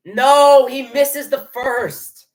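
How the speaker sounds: loud, high wail of dismay; dismayed cry. Real, not acted. Fan watching the first free throw miss, dismayed cry. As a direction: cry out, stretching the words in anguish.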